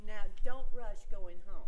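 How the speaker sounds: suddenly loud, pushy warning; loud, fake-caring push to stay. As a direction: call it out with mock concern. Cousins pressing Fergie.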